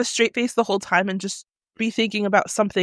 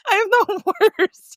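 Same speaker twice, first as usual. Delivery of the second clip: high, laugh breaking into the words; 8 semitones higher than usual. Real, not acted. Friend admitting she spoils emotional moments, laughing. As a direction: confess it while cracking up. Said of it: "I just start laughing".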